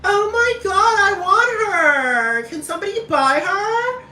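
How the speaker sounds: very high, slow, whimpering falsetto; Paris Hilton whining. Retold or imitated. Paris Hilton begging to buy someone, high whiny falsetto. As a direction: beg for her like a spoiled child at a pet shop. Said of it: "and Paris Hilton was like".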